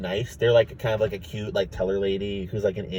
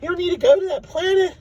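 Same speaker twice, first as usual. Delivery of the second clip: very high teasing Morty voice; mock Rick in Morty's voice; Morty impression. Morty teasing Rick about phone stocks, very high. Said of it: "from be like".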